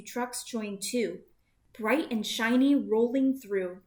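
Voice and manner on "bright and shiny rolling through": plain reading voice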